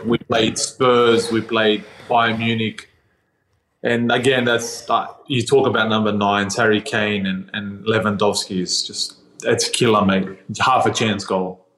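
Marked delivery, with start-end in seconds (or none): none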